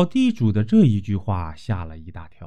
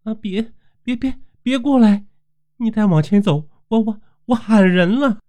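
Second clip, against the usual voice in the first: high, stammering panic, louder as she pushes him off; frightened young woman fending off the landlord, much higher and stammering; terrified; 11 semitones higher, 4 dB louder than usual. Acted. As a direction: back away from him and cry out, stuttering in fear. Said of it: "绣娘惊恐地说道".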